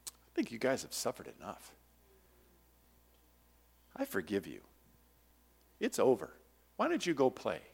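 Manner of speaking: soft and low; gentle forgiving dad. Retold or imitated. Dad forgiving his crying kids, soft and low. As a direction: forgive them kindly. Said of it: "he says".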